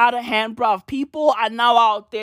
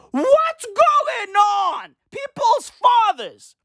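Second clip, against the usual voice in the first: high, slow, drawn-out yell; outraged shout; 12 semitones higher, much slower than usual. Real, not acted. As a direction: disbelief boiling over. Outraged rant peak.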